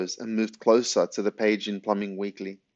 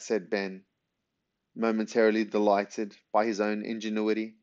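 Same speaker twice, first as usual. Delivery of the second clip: plain reading voice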